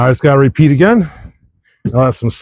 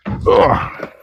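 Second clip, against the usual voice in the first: effort groan; 5 semitones higher, 3 dB quieter than usual. Real, not acted. Climbing over table, groaning.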